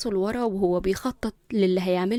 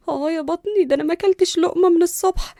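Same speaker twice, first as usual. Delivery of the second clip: high, whining complaint; pained, louder self-pitying whine; hunger pains; 7 semitones higher, 7 dB louder than usual. Acted. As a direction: moan about an aching belly. Complaining of an aching empty stomach.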